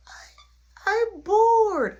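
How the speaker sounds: imitating a bored, tearful kid; whiny little boy. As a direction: whine it like a small child. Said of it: "Stop crying".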